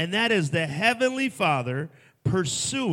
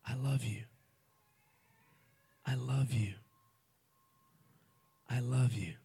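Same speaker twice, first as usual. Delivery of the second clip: tender I love you; 3 semitones lower, 8 dB quieter than usual. Acted. God speaking softly to his children, tender and hushed. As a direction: speak it gently like a loving father. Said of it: "I'll tell you in every possible way".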